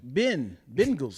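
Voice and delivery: "Ben" set apart, then "Bengals" starting the same way; stressed E syllable. Shown as a demonstration. Co-host explaining the E, "Ben" sounded out before "Bengals". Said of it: "It's the E".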